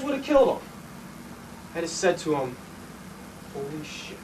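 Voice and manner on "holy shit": low, very soft; quiet, shaken realisation; stunned hush. Acted. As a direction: breathe it out in shock. Stunned after nearly killing man.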